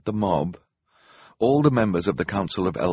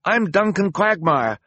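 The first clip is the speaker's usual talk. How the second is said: high, raised character voice; hurried introduction; 10 semitones higher, 4 dB louder than usual. A narrator's reading. Duncan introducing himself to Hector, high and hurried. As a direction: introduce yourself promptly, pitched high. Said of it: "he said quickly".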